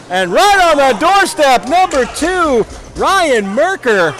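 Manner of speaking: loud, high play-by-play shout; excited goal call. Real, not acted. Announcer calling a goal to viewers, excited shout. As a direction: belt out the goal call, stretching the key words.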